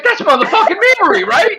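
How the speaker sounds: high, fast, loud disbelieving yell; loud high mock outburst at the show; excited yell. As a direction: shout it at the screen like you can't believe it. Yelling at the stream, retold.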